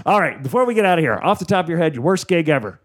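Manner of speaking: ordinary delivery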